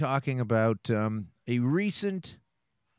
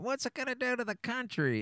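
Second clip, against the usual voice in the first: squeaky, much higher mock voice of a fretting objector; mocking a worried critic; 12 semitones higher, 3 dB quieter than usual. Host mocking cannabis critics in a high squeaky whine. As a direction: whine it like a scandalised pub critic. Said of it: "anyone that's upset about".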